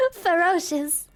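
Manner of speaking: ordinary delivery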